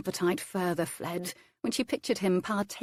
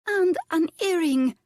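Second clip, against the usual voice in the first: giddy; 12 semitones higher, 6 dB louder than usual. A narrator's reading. Giddy lady adds gossip.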